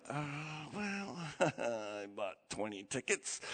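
soft, slow, guilty confession with a laugh; sheepish admission. Retold or imitated. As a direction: own up sheepishly, half laughing. Dad sheepishly admits to wife.

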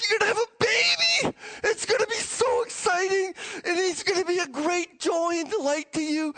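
high, gushing good-news delivery; giddy announcement. Acted. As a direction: announce a baby with hammed-up delight. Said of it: "He was all giddy".

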